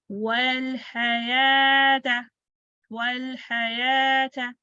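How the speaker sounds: वल हयात very slow, long aa held; two-count elongation. Shown as a demonstration. Teacher models the long vowel, elongated recitation. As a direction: hold the aa in हयात on a level note. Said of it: "two counts".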